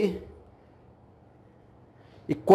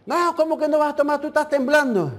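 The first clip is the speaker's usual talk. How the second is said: fast, higher, insistent put-on voice; pushy drunk man; 5 semitones higher, faster than usual. Drunk stranger scolding him for refusing while shivering, fast and insistent. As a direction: rattle off the pressure, disbelieving.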